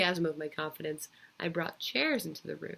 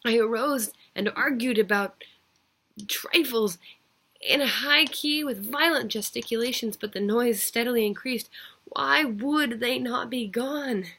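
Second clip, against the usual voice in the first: higher and louder, agitated; rising frenzy; 4 semitones higher, 5 dB louder than usual. A narrator's reading. Killer losing control in front of the officers, agitated high voice. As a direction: let the panic climb in pitch. Rising panic before the police.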